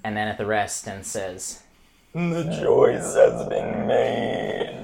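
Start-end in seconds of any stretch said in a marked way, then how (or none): 2.1-4.8 slow, eerie ghost voice; ominous ghost. Acted. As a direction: speak as a doomed spirit. Ghost speaks, slow and other-worldly.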